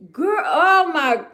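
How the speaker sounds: gasping high and loud in shock. Real, not acted. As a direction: gasp then cry out in disbelief. Shocked reaction to train news.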